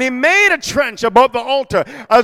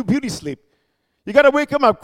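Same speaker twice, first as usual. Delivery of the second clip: ordinary delivery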